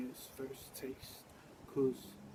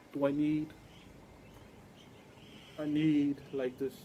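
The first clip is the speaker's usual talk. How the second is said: forceful preaching; 4 dB louder than usual. Acted. Preaching climax.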